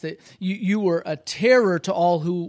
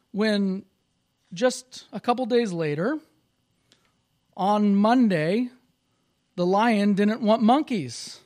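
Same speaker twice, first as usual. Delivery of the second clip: story narration; 6 semitones higher, 6 dB louder than usual. A narrator's reading. Animated story narration building excitement.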